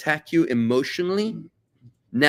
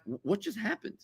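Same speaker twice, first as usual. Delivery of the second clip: acted bewilderment, higher and suddenly hushed; small, stunned reply after being yelled at; taken aback; 6 semitones higher than usual. Friend stunned by a sudden political outburst, taken aback.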